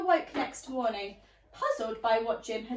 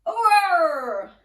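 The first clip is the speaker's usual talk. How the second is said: big playful pirate roar; loud roar; 4 semitones higher, 13 dB louder than usual. Acted.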